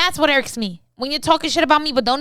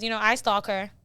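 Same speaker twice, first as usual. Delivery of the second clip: usual speaking voice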